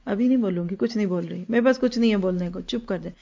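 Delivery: soft, low, dismissive mother's voice; quietly declining. Acted. Mother refusing to answer her child back, quietly declining. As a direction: hush it down, dropping away to very soft.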